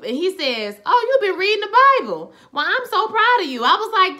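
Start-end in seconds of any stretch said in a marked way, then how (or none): 0.8-3.6 high-pitched, proud cooing; acted sing-song pride; gushing praise. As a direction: gush like a delighted proud elder. Acted praise for reading the Bible.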